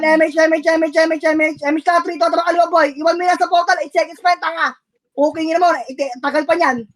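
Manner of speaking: normal talking voice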